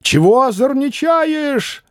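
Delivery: закричал мещанин; shouting. Acted. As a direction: yell at the boy, dragging it out. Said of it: "закричал мещанин".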